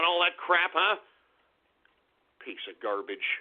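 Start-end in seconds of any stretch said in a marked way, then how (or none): 2.4-3.3 disgusted mutter. Acted. Muttering insults after rant.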